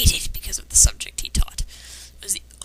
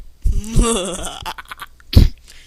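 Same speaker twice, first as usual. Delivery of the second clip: wheezy laugh; 5 dB louder than usual. Real, not acted. Laughs at the boy's line.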